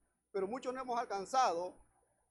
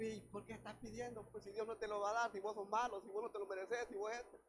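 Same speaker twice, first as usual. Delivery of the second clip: hushed, softer scoffing; discouraging voices; 7 dB quieter than usual. Acted. Preacher voices the enemy's people belittling a believer, quietly scoffing. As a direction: scoff at the pleading man under your breath. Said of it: "que nos llegan a decir".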